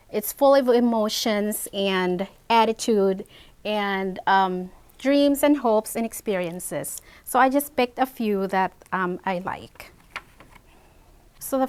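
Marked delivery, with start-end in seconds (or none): none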